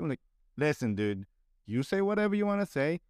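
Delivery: usual speaking voice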